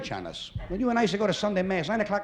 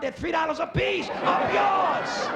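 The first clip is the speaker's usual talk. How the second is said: higher shouted up yours; indignant shout; 8 semitones higher, slower than usual. Real, not acted. Comic griping about restaurant prices, shouted indignation. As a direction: blow up at the prices.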